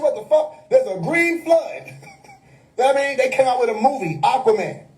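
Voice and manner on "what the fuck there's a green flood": high-pitched, drawn-out disbelief with a laugh; mock astonishment. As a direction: imitate stunned onlookers, amused. Acts out astonished New Yorkers.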